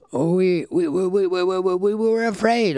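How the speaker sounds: high, stammering terrified chief; frightened stammer. Acted. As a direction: stutter out the fear.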